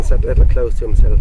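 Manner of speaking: ordinary delivery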